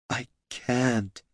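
soft, tearful refusal. Acted. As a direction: near tears, too tired. Loruna gives up in tears.

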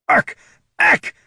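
sharp, high choking barks; barking cough. Acted. Kobold choking on a cigarette, barking coughs. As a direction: hack it out like something's stuck in your throat. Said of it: "could only manage a barking cough".

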